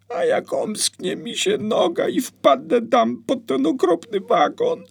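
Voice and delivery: put-on high, loud frightened child voice; a small boy scared of the foal; scared little boy. Scared boy telling mama the foal is kicking, high and frightened.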